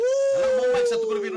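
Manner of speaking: sudden high shout. Real, not acted. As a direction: burst out shouting.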